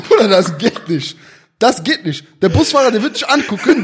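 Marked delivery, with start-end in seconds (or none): 0.1-2.3 laughing loudly while talking. Real, not acted. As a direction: speak through a laugh.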